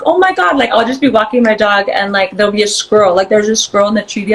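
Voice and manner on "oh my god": high, thrilled "Oh my God"; excited exclamation. Retold or imitated. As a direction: gasp it out high with delight. Excited reaction to squirrel.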